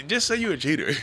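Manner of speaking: exasperated outburst. Real, not acted.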